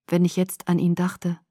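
plain reading voice

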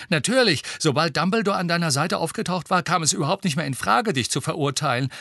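bubbling over with relief; happy. Acted. Boy telling his friend he is cleared, happy and quick. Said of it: "sagte Ron glücklich".

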